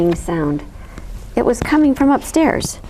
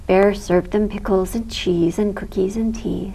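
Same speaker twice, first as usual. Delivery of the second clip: ordinary delivery, nothing marked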